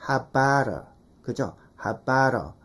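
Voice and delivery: "how about a" as "ha-ba-ra"; low, measured "habara", flapped r for the t; t turned to flap. Shown as a demonstration. Teacher showing the softened consonant, t flapped to r. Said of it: "리을로 바꿔".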